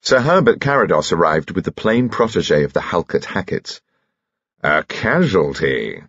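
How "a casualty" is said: slow, drawn-out archness; arch. A narrator's reading. Host brings a partner back to chaperone, arch. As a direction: announce it slowly with arch relish. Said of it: "he said archly".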